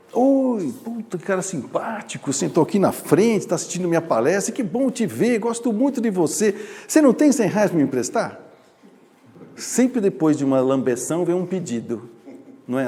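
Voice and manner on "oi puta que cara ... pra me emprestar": higher, flattering sweet-talk; butter them up before asking; a schmoozing con man buttering up a mark; charming hustler. Acted. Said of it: "Como é que o malandro age?".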